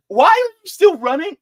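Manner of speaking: high, loud incredulous demand; exasperated outcry. Acted. Asking Nikki why she is still running, loudly exasperated. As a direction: cry it out in disbelief.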